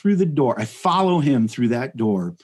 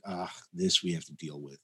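bored sigh; 6 semitones lower, 14 dB quieter than usual. Retold or imitated. Bored tigers voiced.

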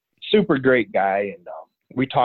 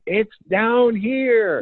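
high, slow, drawn-out call from far away; distant yell; 11 semitones higher, much slower than usual. Retold or imitated. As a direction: call it out long across the mountain. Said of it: "I hear someone yell".

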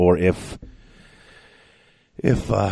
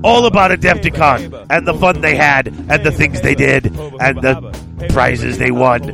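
gruff cockney shouty character; 5 semitones higher, 3 dB louder than usual. Acted. Cold open intro character voice.

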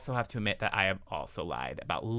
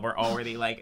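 ordinary talk

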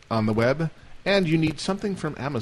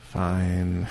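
grudging; 5 semitones lower, 3 dB quieter than usual. Acted. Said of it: "It's a humorous exaggeration".

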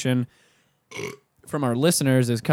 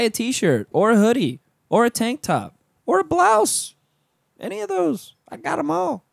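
high, goofy sales voice; silly high voice; 9 semitones higher than usual. Acted. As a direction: pitch it up silly. Silly voice on merch plug.

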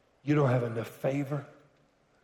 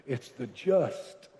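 doubtful devil voice; 6 semitones lower, 6 dB quieter than usual. Retold or imitated. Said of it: "the devil wants you to qualify".

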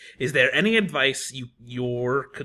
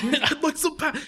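silly high voice; 13 semitones higher than usual. Pretend. Echoes partner's silly voice.

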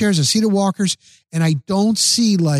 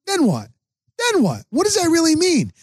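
imitating wife; 12 semitones higher, faster than usual. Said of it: "And she goes".

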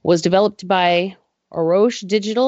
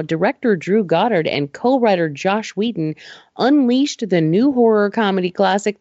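usual speaking voice

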